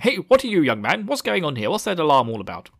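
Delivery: loud, high demanding questions; mock challenge. Onlookers challenge the running young man, mock indignation. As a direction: call out indignantly, hammed up.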